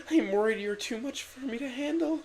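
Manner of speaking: very high, soft female voice; honest Miranda. A narrator's reading. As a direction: admit it sincerely as Miranda. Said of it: "with honesty".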